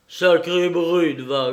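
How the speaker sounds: loud, slow grumble; irritated, heavy-stressed swearing; grumbling curse. A narrator's reading. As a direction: curse the attacker, dragging it out. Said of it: "grogna-t-il".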